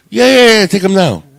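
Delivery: high, loud mock insistence; eager insisting. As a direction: imitate a dad pushing hard over objections. Father eagerly insists she take kids.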